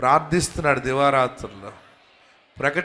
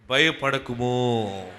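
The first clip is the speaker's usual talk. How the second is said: very slow, drawn out; slow model phrase; 5 semitones lower, much slower than usual. Shown as a demonstration. Preacher leading the congregation to repeat, very slow. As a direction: stretch it for them to repeat. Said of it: "అందరు చెప్పండి".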